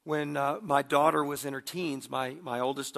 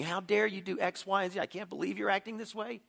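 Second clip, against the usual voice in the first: mild mock scolding, pitched up; finger-wagging scolding; 6 semitones higher than usual. Father hypothetically scolding his son, mock indignant. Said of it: "wagging my finger in his face".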